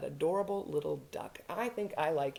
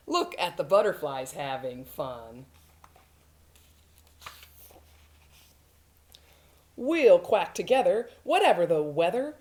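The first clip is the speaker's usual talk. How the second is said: lively, coaxing storybook voice; encouraging parent duck; 4 semitones higher, 8 dB louder, slower than usual. Acted. Dad duck cheering up sad Ducky, playfully encouraging. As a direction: cheer up a little one with a playful lilt.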